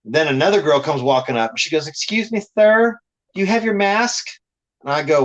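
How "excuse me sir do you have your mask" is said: higher-pitched mock customer-service challenge; imitated officious worker. Shop worker stops a customer about his mask, imitated officious.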